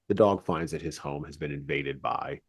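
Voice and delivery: ordinary talk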